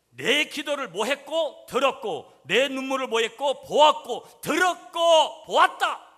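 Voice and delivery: preaching call and response shout. Real, not acted. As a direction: shout each call for the crowd to answer. (회중과 주고받으며 크게 외치기)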